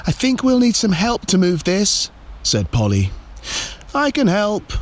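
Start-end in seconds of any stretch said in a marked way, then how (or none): 0.1-2.1 put-on high, effortful child's voice; puffed little-girl voice; straining. A narrator's reading. Straining to lift heavy pumpkin. 3.9-4.7 high, singing-out offer; mum's high call. A narrator's reading. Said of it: "called her mum".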